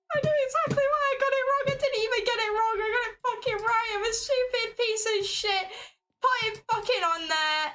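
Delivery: high, fast, furious self-scolding; exasperated yelling at the screen; frustrated shriek. Real, not acted. Realizes she got it right.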